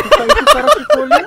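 high, loud laughing jab. Real, not acted. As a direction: tease through laughter. Teasing teammate, laughing.

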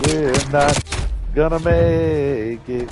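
very drawn-out, melodramatic doom; mock despair. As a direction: stretch out MAKE like a tragic last line. Repeats the line as mock drama.

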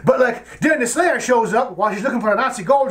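ordinary delivery

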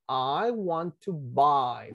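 "I want to buy" stretched out slowly; very slow, careful model of "I want to buy"; pronunciation model. Shown as a demonstration. Teacher shows students how it is pronounced, slow demo. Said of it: "Fíjense la pronunciación".

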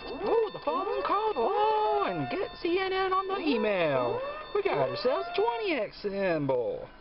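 high, loud, thrilled yelps; excited. Real, not acted. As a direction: burst out with winning joy. Finding a winning symbol.

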